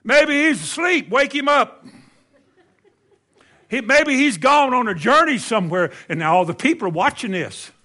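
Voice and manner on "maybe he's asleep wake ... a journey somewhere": high, mocking taunt; hammed-up high heckling; Elijah. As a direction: jeer at them in a raised, gleeful voice. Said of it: "And here's Elijah".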